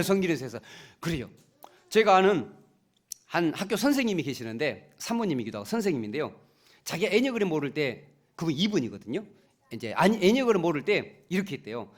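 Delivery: ordinary talk, nothing marked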